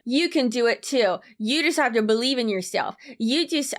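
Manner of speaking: hammed-up encouragement in an uncertain accent; mock motivational voice. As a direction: imitate a self-help cheerleader, mocking. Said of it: "I don't know what this accent is".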